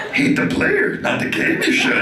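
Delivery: very high croaky little alien voice; Yoda. Retold or imitated. Yoda giving advice, high and croaky. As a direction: squeeze the words up like Yoda. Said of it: "Yoda would be the same shit".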